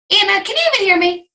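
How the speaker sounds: high, fast call across the line; high, hurried summons. Real, not acted. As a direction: call her name to get attention. Calling to muted attendee.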